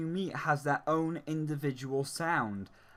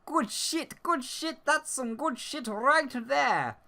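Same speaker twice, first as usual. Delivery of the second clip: much higher squeaky meme voice; high, cartoonish meme squeal; good shit meme voice; 10 semitones higher than usual. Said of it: "like the voice of the good shit meme".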